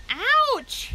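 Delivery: very high, loud yelp of pain; pig's scream. A narrator's reading. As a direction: shriek as the tail gets bitten. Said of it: "he screamed".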